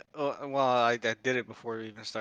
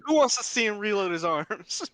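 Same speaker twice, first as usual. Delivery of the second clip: high, louder mock Tom Cruise pitch; imitating Tom Cruise; 9 semitones higher, 6 dB louder than usual. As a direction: ham it up like a press tour host, higher. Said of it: "Tom Cruise like".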